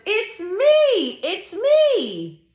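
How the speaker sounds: high, sing-song reveal with stretched ME; gleeful high animal voice for kids; excited horse. Acted. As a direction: announce yourself gleefully as the horse.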